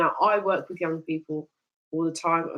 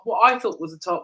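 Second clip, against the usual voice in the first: normal talking voice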